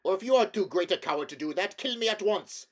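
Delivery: loud, high, raging challenge; a humiliated prince shouts a challenge to the king; furious shouting. A narrator's reading. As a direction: spit the dare out in fury. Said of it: "howled Rabidash".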